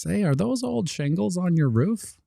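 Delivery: pitched high, nosy official; high mock inspector. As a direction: greet like a prying inspector.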